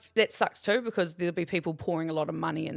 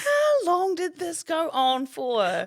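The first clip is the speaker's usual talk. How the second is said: high, slow drawn-out disbelief; amused, wailing incredulity; incredulous; 9 semitones higher, 4 dB louder, much slower than usual. Real, not acted. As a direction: stretch every word in exasperation. Listener incredulous at the long lecture.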